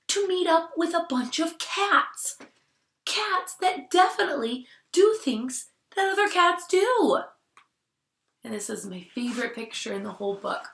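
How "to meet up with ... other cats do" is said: climbing higher toward the end; rising excitement. A narrator's reading. Teacher building surprise for the class, rising excitement.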